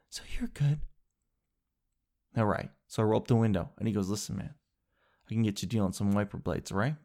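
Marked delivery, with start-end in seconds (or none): 0.1-0.8 hushed, airy official voice; put-on soft bureaucrat; mock government official. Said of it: "then the government is like". 4.1-6.9 low, soft and quick; imitated hush-hush salesman; confidential sales pitch. Mechanic confidentially offering a deal.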